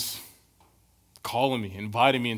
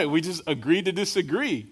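laughing; 6 semitones higher, 5 dB louder than usual. Real, not acted. Joking about argument with wife.

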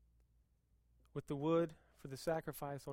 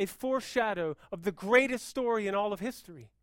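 loud, rising preacher's voice; full-force preaching; 6 semitones higher, 7 dB louder than usual. Acted. Pastor stirring the church, preaching at full force. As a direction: preach it out to the room.

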